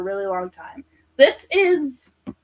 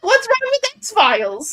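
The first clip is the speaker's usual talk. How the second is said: mock-offended, high and loud; indignant; 12 semitones higher, 5 dB louder than usual. Real, not acted. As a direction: defend the show in outrage. Indignant follow-up.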